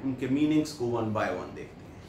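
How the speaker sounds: normal talking voice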